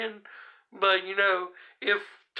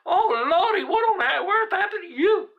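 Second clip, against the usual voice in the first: high, loud, laughing imitation of a Jamaican lady's accent; bad Jamaican accent; 11 semitones higher, 5 dB louder than usual. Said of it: "I can't do a good Jamaican voice".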